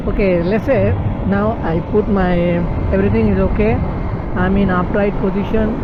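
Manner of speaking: shouting above the wind on a ride; loud over wind. Real, not acted. Riding fast, speaking over wind.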